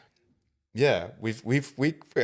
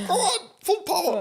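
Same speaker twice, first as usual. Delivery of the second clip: excited; 4 dB louder than usual. Retold or imitated. Urging the lawyer, laughing.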